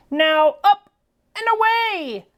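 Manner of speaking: loud, high rising call; jolly call. Acted. Santa calling his reindeer to fly, loud and jolly. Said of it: "he called with a chuckle".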